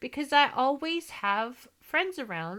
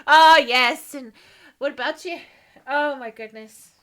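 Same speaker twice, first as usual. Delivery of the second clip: ordinary talk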